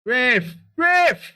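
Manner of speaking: high, loud shout at the ref; yelling from the sidelines. Real, not acted. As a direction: bellow at the referee. Appealing to referee from sidelines.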